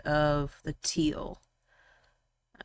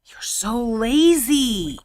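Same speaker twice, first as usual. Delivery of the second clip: slow, louder, higher playful complaint; teasing scold; 5 semitones higher, 5 dB louder, slower than usual. Real, not acted. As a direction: draw it out in mock reproach. Teasing the reluctant narrator.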